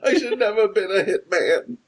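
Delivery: high, wailing mock sob; mock crying. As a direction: pretend weeping, rising to a squeal. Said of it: "He starts crying".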